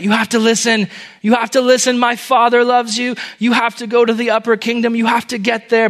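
high, urgent pleading call; crying out. A narrator's reading. Prince at the stake begs the crowd to listen, crying out. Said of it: "And so he cried out".

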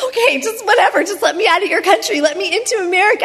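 high, fast, laughing exasperation; tired traveller pleading to be let home, fast and high; exasperated. Retold or imitated. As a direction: rush it out, fed up. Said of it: "And I'm like".

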